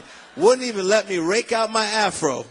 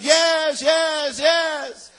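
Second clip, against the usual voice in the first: high shouted reply, repeated like an echo; 10 semitones higher, 5 dB louder, much slower than usual. Retold or imitated. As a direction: holler back in drawn-out echoes. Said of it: "and it echoes".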